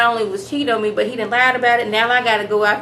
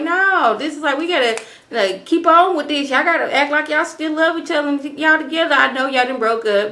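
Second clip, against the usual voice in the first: higher, pushy mock Elizabeth; a hammed-up bossy boss in a higher voice; urgent insisting; 6 semitones higher than usual. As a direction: press them hard to keep up the act. Elizabeth urges couple to act.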